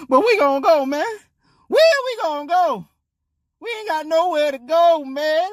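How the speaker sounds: high-pitched distraught complaint; whiny pleading. Acted. Adam protests banishment to God, whiny pleading. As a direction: plead like you're being thrown out.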